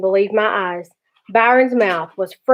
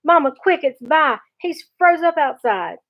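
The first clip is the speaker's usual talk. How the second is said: put-on higher, urgent call of a boy; urgent alarm; 6 semitones higher than usual. A narrator's reading. Boy tells Mama his brother is stuck, urgent alarm. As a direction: raise the alarm in a pitched-up voice.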